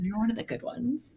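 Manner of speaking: soft and sly, a little sarcastic; teasing mock compliment. To her fiance, a sly backhanded compliment, softly sarcastic. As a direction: purr it at him as a backhanded compliment. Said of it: "I'm gonna start saying that to him".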